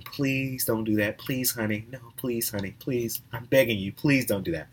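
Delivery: softly imitated begging mother; mock pleading. Clerk imitating a guest begging her kids, softly pleading. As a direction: plead quietly and weakly, as an imitation. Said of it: "you're begging your kid".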